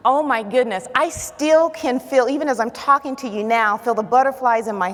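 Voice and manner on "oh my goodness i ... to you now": ordinary talk, nothing marked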